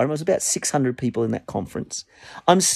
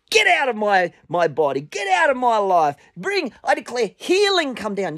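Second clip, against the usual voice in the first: high-pitched preaching command; commanding prayer, pitch raised; forceful declaration; 9 semitones higher than usual. Shown as a demonstration. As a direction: declare it with authority. Demonstrates declaring with authority.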